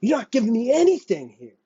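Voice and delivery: high, strained complaint; high exasperated whine; exasperated plea. Real, not acted. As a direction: cry out in exasperation, voice climbing.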